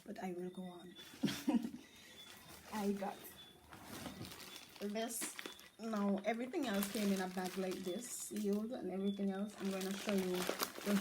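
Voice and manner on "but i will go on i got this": sighing, then pushing on slowly; weary but determined to keep going; tired but firm. Real, not acted. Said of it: "I'm tired".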